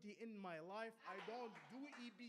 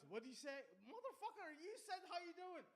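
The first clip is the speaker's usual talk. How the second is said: mock angry cop; 8 semitones higher than usual. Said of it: "And he goes".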